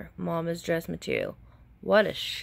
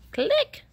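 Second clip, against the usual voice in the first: camera click; 5 dB louder than usual. Acted.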